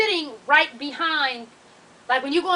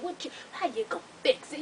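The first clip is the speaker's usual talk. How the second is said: much softer imitation of him; creepy man's act; 11 dB quieter than usual. He questions the triage lady, hushed mimicry. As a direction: drop the volume and mimic his question. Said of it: "doing this shit".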